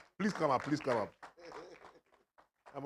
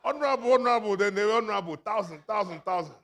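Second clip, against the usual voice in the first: high, loud mock crowd calling out; laughing imitation of mourners cheering; crowd hailing; 9 semitones higher, 11 dB louder than usual. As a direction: hail the politician like an eager crowd. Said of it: "and I heard them".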